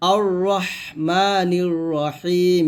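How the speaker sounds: slow, drawn-out teaching recitation; measured chant, each syllable stretched; Quran recitation. Shown as a demonstration. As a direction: recite with long held vowels. Recites the verse.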